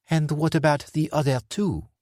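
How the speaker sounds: a sly, menacing employer; Dufour's voice. Acted. Dufour's voice, higher.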